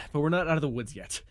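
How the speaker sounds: ordinary talk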